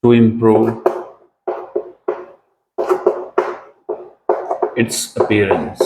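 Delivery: very slow, word by word; slow dictation. Shown as a demonstration. Teacher dictating the meaning to students, very slow. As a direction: dictate the meaning slowly for notes. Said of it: "इसका meaning है".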